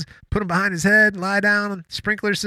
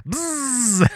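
buzzing gun noise; 8 semitones higher than usual. Pretend.

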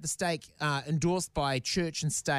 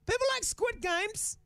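much higher, slow whiny protest; high pleading defence. Real, not acted. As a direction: plead your case in a squeaky voice. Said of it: "defending herself playfully".

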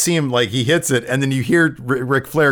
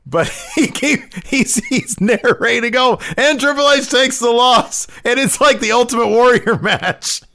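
high-pitched, laughing retelling; laughing while talking; 12 semitones higher, 3 dB louder than usual. Real, not acted. Cracking up retelling the commentator narrating losses, laughing. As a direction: barely get it out through laughter.

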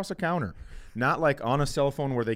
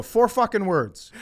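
mock toast; 8 semitones higher, 5 dB louder, slower than usual. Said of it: "hold up my drink and go".

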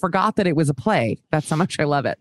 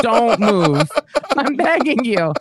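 mock desperate artist, pitched up; pleading caricaturist; 5 semitones higher, 4 dB louder, slower than usual. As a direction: plead loudly as an exasperated sketch artist. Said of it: "sat her down and was like".

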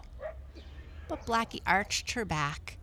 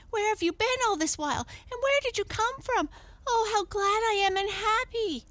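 put-on high, fast, excited boy; boy crying out; 4 dB louder, faster than usual. A narrator's reading. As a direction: gush in a high, louder voice, overjoyed. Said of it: "cried Arthur".

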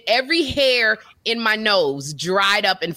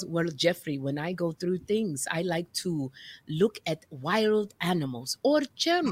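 much lower, softer tutor voice; calm tutor; 6 semitones lower, 6 dB quieter than usual. Retold or imitated. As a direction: soothe like a mellow counselor. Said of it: "the tutor goes".

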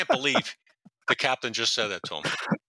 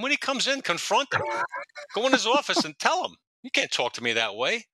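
acted urging with pitch leaps; mildly indignant egging on; 12 semitones higher than usual. Detectives egging Eddie on against captain, mock indignant. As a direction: work him up, lightly mock-outraged.

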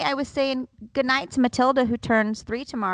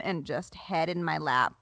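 usual speaking voice

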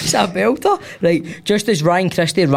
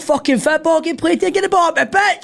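much higher shouting fan impression; mock fan shout; 12 semitones higher than usual. Comic re-enacting a fan at the game, mock shout. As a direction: imitate an irate fan in a high-pitched yell. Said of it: "he's heard that guy shout".

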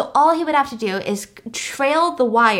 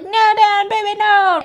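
high, loud pleading cry of no; desperate pleading; 10 semitones higher, 5 dB louder than usual. Retold or imitated. Parker begs her boyfriend not to jump, high pleading cry. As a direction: beg him not to jump, voice pitched up high. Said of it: "And Parker was like".

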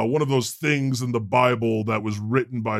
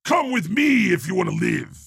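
action hero shout; 4 semitones higher than usual. Shown as a demonstration. Friend showing how an action hero would say it. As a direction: sell it like a movie tough guy. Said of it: "No, you gotta be like".

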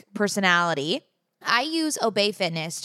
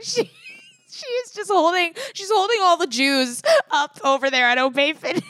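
high laughing talk; 8 semitones higher than usual. Real, not acted. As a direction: giggle through the words. Laughing while praising instructor.

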